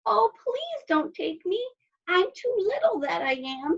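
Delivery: high, pleading little goat; very small voice. A narrator's reading. Littlest goat begging the troll, tiny high voice. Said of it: "in his very small voice".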